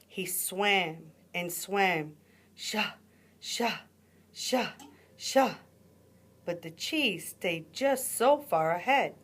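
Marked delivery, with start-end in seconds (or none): 2.5-5.6 rising sha sha sha of strokes; rising, growing swish of swimming; swimming splash sounds. A narrator's reading. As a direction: paddle the water with each sha. Coyote swimming sound effect.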